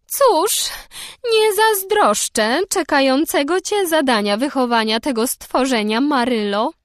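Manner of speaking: high, loud and pompous; solemn. A narrator's reading. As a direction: pronounce it with grave ceremony. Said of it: "rzekła nadzwyczaj uroczyście".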